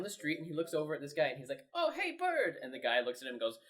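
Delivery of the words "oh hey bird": high, raised-voice hail; calling out greeting. Retold or imitated. Passerby spotting Bird on the street, calling out. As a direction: hail someone across the street, high-pitched.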